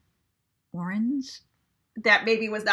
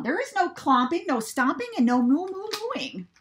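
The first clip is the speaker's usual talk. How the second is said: much higher, prim lecturing farmer; prim Farmer Brown; 7 semitones higher, 3 dB louder than usual. A narrator's reading. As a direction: lay down the rule primly. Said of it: "said Farmer Brown".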